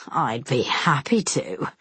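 low, slow mock politeness; cruel sneer. A narrator's reading. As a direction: fake courtesy before cruelty. Semyon's cruel reply.

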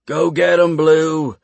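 loud rough villager yell. A narrator's reading. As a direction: call the dogs with a shout. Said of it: "a man yelled".

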